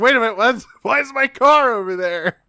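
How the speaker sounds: high, loud comic disbelief; mock bewilderment. Man spots his own car over there, mock bewildered. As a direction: squawk it in exaggerated, put-on confusion. Confused character acted in recap.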